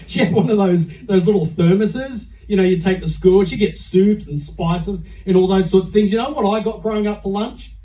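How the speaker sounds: ordinary delivery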